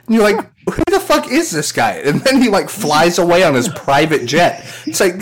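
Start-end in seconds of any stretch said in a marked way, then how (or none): 0.7-2.0 mock bewildered outrage. Bewildered host quoted.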